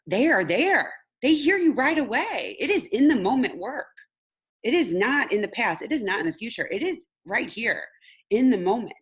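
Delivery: high, loud hammering on each "is"; emphatic insistence. Real, not acted. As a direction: drive the point home word by word. Emphatic teaching point.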